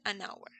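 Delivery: "hour" starting on the vowel, no H sound; silent H. Shown as a demonstration. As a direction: link "an hour" as if it were "a-nour". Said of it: "la H es muda".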